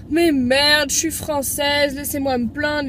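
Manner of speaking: loud indignant outburst; ranting. Real, not acted. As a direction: vent the frustration, loud. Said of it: "Coup de gueule".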